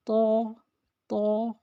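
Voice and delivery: ordinary talk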